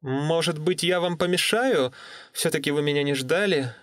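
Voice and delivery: put-on unsure, polite young woman. Natalie on the phone.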